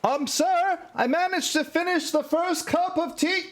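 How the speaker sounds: very high-pitched, eager-to-serve sidekick; squeaky-high loyal sidekick reporting to the boss; Wutso. Acted. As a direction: pitch it way up as a deferential tea-making servant. Game master plays tea-making sidekick.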